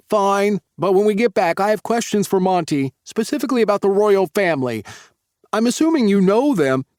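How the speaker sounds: put-on high, loud, pushy young man; grudging but still bursting with questions; reluctant Tyler. Tyler grudgingly leaving for water but still demanding answers, high and loud.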